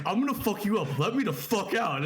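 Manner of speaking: retold anger, mildly heated; mock angry threat. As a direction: act out a backseat threat. Retold threat in backseat fight.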